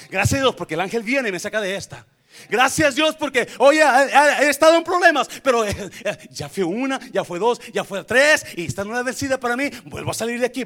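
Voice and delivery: impassioned, driving preacher's cry; fervent preaching. Acted. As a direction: shout thanks to God with pulpit force. Preaching at full force.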